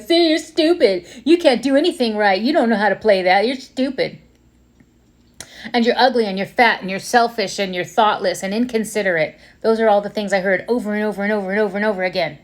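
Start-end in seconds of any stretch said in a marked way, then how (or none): 0.0-9.4 mock the player for every mistake; inner critic. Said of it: "somebody to be like".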